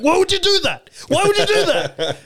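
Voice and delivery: high, loud yell of disbelief; screaming at the screen. Retold or imitated. Frustrated viewer to TV show's characters, screaming at the screen. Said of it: "we're just screaming at the screen".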